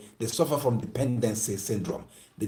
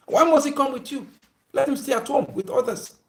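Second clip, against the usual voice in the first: indignant objection; 12 semitones higher, 4 dB louder than usual. Retold or imitated. Same objection repeated.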